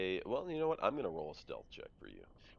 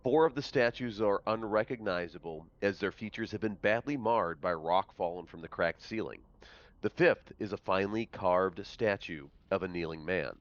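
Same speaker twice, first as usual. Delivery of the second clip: louder, measured read-aloud narration; game master's scene setting; 8 dB louder than usual. A narrator's reading. Game master describes a rubble strewn vault to players, projected narration. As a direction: announce the room to the players.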